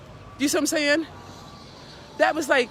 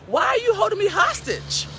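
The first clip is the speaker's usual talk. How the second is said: much higher, rising into a loud indignant cry; indignant outburst; 11 semitones higher, 4 dB louder than usual. Retold or imitated. Speaker replays her answer to a friend keeping score: loud outraged cry. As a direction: fling it back at them, outraged. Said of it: "And I'm like".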